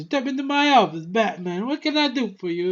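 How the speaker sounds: high-pitched, showy club boss; Penguin impression. Penguin inviting Batman into his office, high character voice. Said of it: "He goes".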